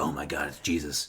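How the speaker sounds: very low, hushed mock awe; awed apes. Retelling the apes seeing the chimp land, hushed awe. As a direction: drop deep and soft, as if witnessing a miracle.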